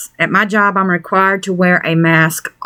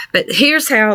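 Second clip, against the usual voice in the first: ordinary delivery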